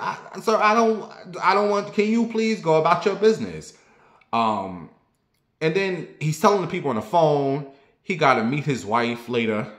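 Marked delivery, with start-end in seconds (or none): 0.0-3.8 high strained plea. Retold or imitated. Begging the stranger to leave, high and strained. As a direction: voice climbing, pleading to be left alone.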